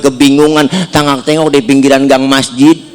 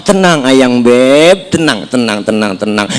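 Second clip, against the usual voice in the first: calming tenderly; 6 semitones lower than usual. Acted. Prophet soothing angry wife.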